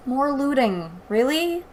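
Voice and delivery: incredulous. Real, not acted. Reader scolding the looting character, incredulous. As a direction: roll your eyes at the character.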